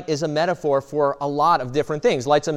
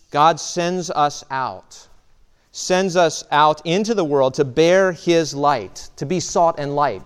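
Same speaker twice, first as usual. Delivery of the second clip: slow solemn commission. Acted. Sermon climax commission.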